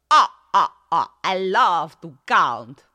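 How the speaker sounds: loud, slow cartoon vampire; booming staccato laugh, then drawn-out words; Count Dracula. Shown as a demonstration. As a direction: ham up a twangy American vampire. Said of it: "I'm kinda playing with his voice there".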